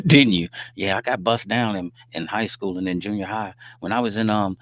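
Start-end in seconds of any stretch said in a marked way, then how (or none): 0.1-0.5 loud laughing jab; teasing through a laugh; laughing tease. Real, not acted. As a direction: laugh it out at the listener.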